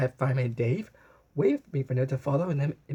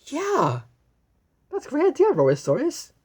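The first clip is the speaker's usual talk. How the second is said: excited; 13 semitones higher, 6 dB louder than usual. Acted. Excited agreement.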